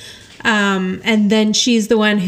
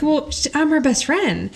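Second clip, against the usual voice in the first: higher-pitched mild huff; mock indignant; 5 semitones higher than usual. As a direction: imitate a miffed best friend. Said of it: "she's all mad".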